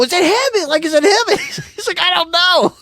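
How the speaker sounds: very high, strained, near squealing; can barely contain the thrill; giddy excitement. Retold or imitated. Reliving finding the buck.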